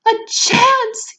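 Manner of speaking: very high, loud outraged yell; mother yelling at the chocolate maker. Acted. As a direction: yell it in disbelief. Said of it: "yelled Missus Salt".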